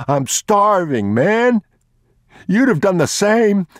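a starving thief begging the father; desperate thief. Acted. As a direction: cry out your hunger.

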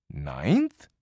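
plain reading voice

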